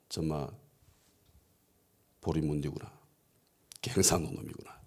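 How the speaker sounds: low, hushed aside; under the breath, dropping low; muttered realisation. Acted. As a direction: murmur it to yourself on catching on. Seoul people silently realising his origin.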